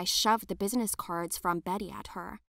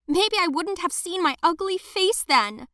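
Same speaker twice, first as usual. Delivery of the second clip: much higher and louder, despairing and bitter; bitter outburst; 9 semitones higher, 7 dB louder than usual. Acted. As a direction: lash out through the pain. Screaming in horror at her face.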